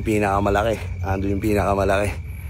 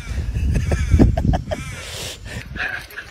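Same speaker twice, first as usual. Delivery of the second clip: high wheezing laugh; 11 semitones higher, 4 dB quieter than usual. Real, not acted. Laughing after taunting kangaroo.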